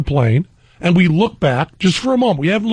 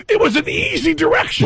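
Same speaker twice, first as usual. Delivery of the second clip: mock innocent reply; 12 semitones higher than usual. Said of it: "We said".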